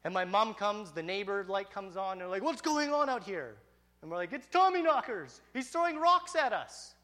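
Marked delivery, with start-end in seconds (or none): 2.4-3.5 high, loud questioning call; imitated mom demanding an explanation; alarmed mom. 4.3-7.0 high, loud excited cry; acted-out panicked kid yelling; frantic tattling. Kids cry out to mom.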